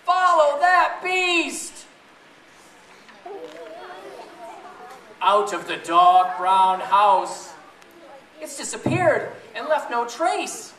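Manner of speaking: lively, sing-song storybook reading; reading to children. Acted. Mother reading a beastie story to her children, sing-song and lively. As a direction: read it big and playful for the kids. Reads picture book aloud to kids.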